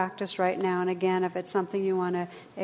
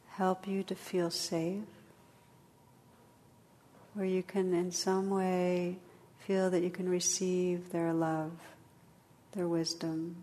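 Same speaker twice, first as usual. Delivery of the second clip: guided meditation; 3 dB quieter than usual. Acted. Leads a meditation.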